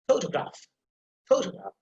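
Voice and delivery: photograph with PHO stressed, middle vowel reduced; stress on first syllable. Shown as a demonstration. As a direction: hit PHO in photograph, lighter GRAPH. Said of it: "it has been stressed".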